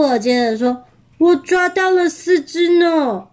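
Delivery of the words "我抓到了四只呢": higher, lively wolf voice; pitched-up storybook bragging; proud boast. Acted. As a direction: chime in with your own catch. Second wolf boasts of catch.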